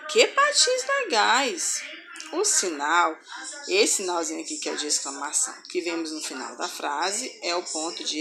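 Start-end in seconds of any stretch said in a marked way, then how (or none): 0.1-1.8 exclamation. Shown as a demonstration. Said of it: "Olha a entonação que a gente tem que ler".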